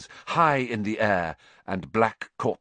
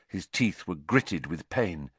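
plain reading voice

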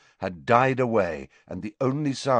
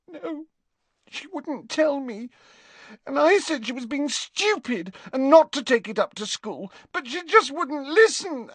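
put-on high, tearful girl's voice breaking into crying; a frightened friend near tears; high girl voice, sobbing at the end; distraught girl; 3 dB louder than usual.

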